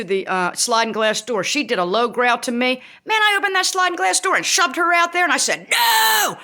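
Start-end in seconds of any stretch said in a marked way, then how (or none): 3.0-6.4 worked-up reenactment of yelling at the dog; flipping out. Retold or imitated. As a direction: build into a high, shouted refusal. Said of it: "I was flipping out".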